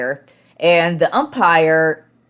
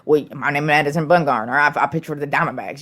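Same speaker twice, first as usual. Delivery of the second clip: fast, barely understandable Boomhauer-style Southern accent, a rough imitation; quick mock Boomhauer-style accent with words run together; Boomhauer-style accent as Madison Bumgarner. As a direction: rattle it off quickly like Boomhauer from King of the Hill, hard to make out. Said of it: "hilarious accent where he sounds like, Boomhauer".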